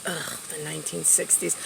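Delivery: low, very soft groan of distaste; disgusted mutter. Retold or imitated. Daughter reacting to dad's revolution story, low disgusted mutter. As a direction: mutter it under your breath, disgusted.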